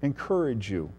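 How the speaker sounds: soft, meek counsel with long pauses; soft-spoken and deferential, falling low at the end; gracious, meek appeal. Acted. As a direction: gently encourage the king, hushed and unhurried. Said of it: "very graciously and very meekly, Daniel says".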